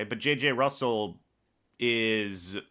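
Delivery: ordinary talk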